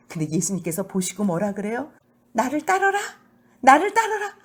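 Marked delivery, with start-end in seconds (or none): 2.3-4.3 high, loud call of Jesus; a preacher voicing Jesus's call. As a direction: call him out warmly and strongly. Jesus calling Matthew in sermon.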